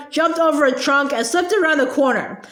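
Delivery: ordinary delivery, nothing marked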